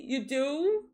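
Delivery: ordinary talk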